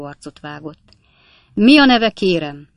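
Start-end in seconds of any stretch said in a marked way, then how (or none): 1.5-2.7 lightly voiced judge, loud and high at the start; questioning judge. A narrator's reading. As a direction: open sharply, then drop off. Judge questions witness in court.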